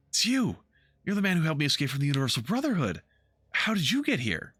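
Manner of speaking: put-on high, breathless young elf girl; high startled elf girl; frightened young elf. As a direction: voice her high and startled, like she's seen a ghost. Elf Marie-Louise voiced by GM.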